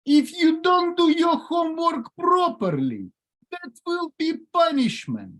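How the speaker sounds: high-pitched, strident mock-Ukrainian scolding; stern Ukrainian parent. Ukrainian parent warns a son about homework and punishment, mock-stern. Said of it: "decided to be a real Ukrainian with my son".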